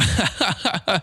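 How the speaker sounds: high, bubbling laugh; chuckle. Real, not acted. Host joking about his wife's customer service, chuckling. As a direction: laugh at your own joke.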